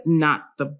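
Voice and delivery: normal talking voice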